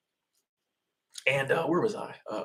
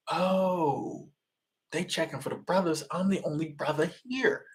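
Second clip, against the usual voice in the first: hushed, higher retold realization; dawning realization; 5 semitones higher, 5 dB quieter, slower than usual. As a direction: act out catching on, softly, with a long "oh". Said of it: "So I'm like".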